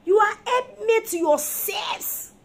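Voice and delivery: high, loud and slow; emphatic loud. Real, not acted. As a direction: drive it home to the men. Preacher rebuking husbands.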